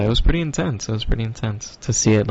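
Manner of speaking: normal talking voice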